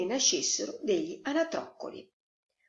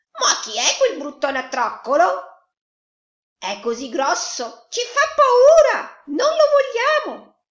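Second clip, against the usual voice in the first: loud, high clamour, climbing to a squeal; alarmed farm animals; 14 semitones higher, 13 dB louder than usual. A narrator's reading. Farmyard animals reject the duckling, in shrill uproar. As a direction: build from loud outcry to very high protest.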